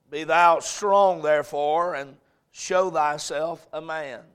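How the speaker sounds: solemn Bible reading; scripture reading. Acted. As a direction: read the verses with weight. Reads scripture aloud.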